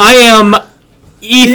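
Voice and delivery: mock hesitant introduction. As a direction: imitate someone slowly recalling their own name. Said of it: "look at me for confirmation".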